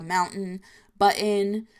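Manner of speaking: MOUNTAIN and BUTTON with the T swallowed; dropped middle T's. Shown as a demonstration. Showing New Jersey speech, middle T's not pronounced. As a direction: catch the T in the throat, "moun'n", "bu'n". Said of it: "we don't pronounce T's in the middles".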